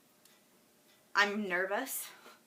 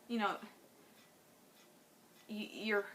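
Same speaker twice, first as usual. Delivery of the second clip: near tears; slower than usual. Real, not acted. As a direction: whisper the hurt through tears. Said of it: "I'm gonna try not to cry".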